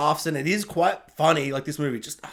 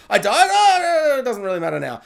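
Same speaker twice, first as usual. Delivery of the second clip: mock dismissive wail; 5 semitones higher, 6 dB louder than usual. Comic character back from the dead, mockingly overblown cry. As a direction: make a big silly show of it. Acts revived character shrugging off death.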